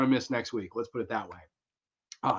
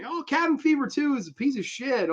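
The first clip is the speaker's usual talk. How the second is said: high mock coworker; 13 semitones higher than usual. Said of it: "she was saying".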